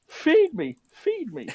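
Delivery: very high, loud squeaky plea to be fed; hungry headset voice. Retold or imitated. Headset begging for a charge in a squeaky high voice. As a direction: squeal it like a starving gadget. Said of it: "charge it again".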